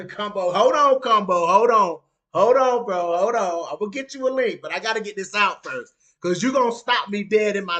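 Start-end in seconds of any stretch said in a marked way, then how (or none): 0.1-3.7 louder, cutting the chatter off; loud hold on. Real, not acted. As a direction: hold up, let me finish. Stopping a chatter mid-rant.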